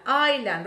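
Island said eye-lan, silent s, firm falling; correct island. Shown as a demonstration. Teacher drilling the class on silent-s Island.

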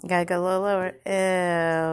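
normal talking voice

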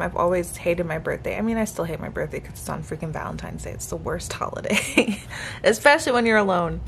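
ordinary talk, nothing marked

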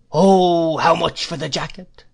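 put-on high, loud old man's wail; crazed old dealer's high singsong; whining cry. A narrator's reading. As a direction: swell on a long "Oh", then whine the question. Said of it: "cried the old man".

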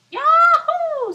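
high, loud joyful whoop; excited yahoo. Acted. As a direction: cheer it like a bouncy bunny. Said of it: "said the bunny".

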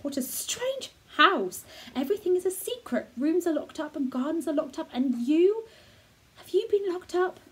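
put-on a girl realising the whole house is secret; wondering girl. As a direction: let the amazement build as she speaks. Mary voiced by reader.

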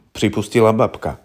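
plain reading voice

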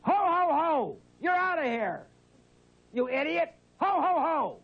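high-pitched exasperated yelling; getting mad. Real, not acted. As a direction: bellow ho-ho-ho like an irate Santa. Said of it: "I'm getting mad now".

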